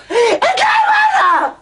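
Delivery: high, slow, shrieked order; furious shout. Acted. Wife screaming at Zeinab to get out, furious. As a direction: scream it at her, stretching the words.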